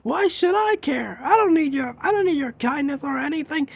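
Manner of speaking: high-pitched, indignant outburst at moderate volume; mock yelling. Hothead spurning a classmate's kindness, mock yelling. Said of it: "stop yelling at me".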